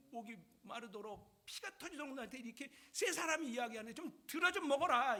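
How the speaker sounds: loud, forceful mock berating; cursing tirade. Three friends cursing Job, loud angry rebuke. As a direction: lay into him with acted, exasperated force. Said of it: "욥을 저주를 합니다".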